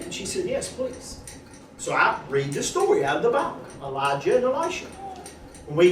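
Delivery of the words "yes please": barely voiced, much softer; soft whispered yes please. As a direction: breathe it out gently like a shy wife. Wife's soft request.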